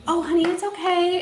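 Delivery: put-on soft, high reassuring voice to a child; gentle reassurance. Mom reassuring the embarrassed foster child, gently soothing. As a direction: soothe a scared little girl, lightly.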